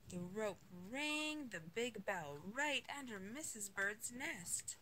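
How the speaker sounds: usual speaking voice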